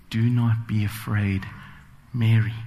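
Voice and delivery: put-on tenderly, as if calming a frightened girl; warm and sensitive. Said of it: "a very warm and sensitive".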